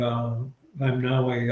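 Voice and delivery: usual speaking voice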